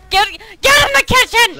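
loud, high playful order; mock bossy shout. Player jokingly ordering a teammate about, mock bossy shout. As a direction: yell a joking command.

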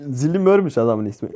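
normal talking voice

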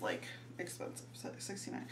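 hushed whisper at the price. Real, not acted. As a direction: wince quietly at the cost. Wincing at price.

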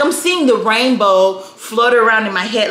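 usual speaking voice

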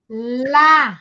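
"lá" as one isolated, drawn-out clap syllable. Shown as a demonstration. Teacher counting syllables with claps, separated "lá". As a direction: stretch LÁ and stop. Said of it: "Fala a palavra e vai batendo palma".

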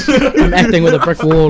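laughing through the words; amused, chuckling while talking. Real, not acted. Acting against nothing on set.

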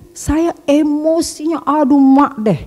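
a hint of the rage she describes; exasperated. Real, not acted. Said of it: "Kalau udah ngamuknya nih". (sedikit meniru amarahnya, nada tinggi)

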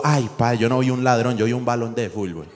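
usual speaking voice

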